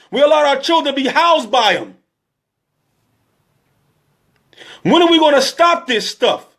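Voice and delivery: high, urgent sermon voice, pushing fast in places; preaching at full force. Real, not acted. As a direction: hammer the congregation with each question.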